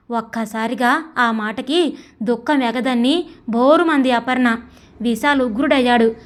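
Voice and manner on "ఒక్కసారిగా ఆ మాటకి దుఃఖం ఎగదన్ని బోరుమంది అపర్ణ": ordinary delivery, nothing marked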